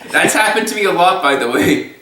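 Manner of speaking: high, quick words through laughter; real giggly aside; laughing. Real, not acted. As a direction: talk while still cracking up. Still laughing after the impression.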